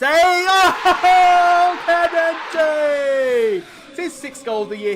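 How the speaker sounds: very high, very loud commentator scream; goal shout. Real, not acted. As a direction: yell the scorer's name like a commentator.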